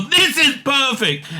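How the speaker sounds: high, drawn-out and emphatic; delighted exclamation. Real, not acted. Reader thrilled by the AI's script, delighted. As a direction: savour every word with delight.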